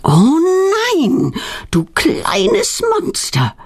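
long exasperated groan. A narrator's reading. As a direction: moan it in acted dismay. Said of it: "stöhnt ihre Mutter".

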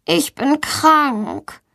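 put-on high, drawn-out, ailing child; suffering voice. A narrator's reading. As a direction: drag it out pitifully, slowly. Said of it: "mit leidender Stimme". (mit leidender Stimme, hoch und gedehnt)

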